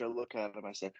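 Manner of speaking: ordinary delivery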